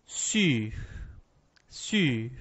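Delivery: sur with French u, rounded front vowel; French sur, lips pushed forward. Shown as a demonstration. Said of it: "eu digo".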